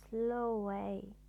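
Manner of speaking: ordinary delivery, nothing marked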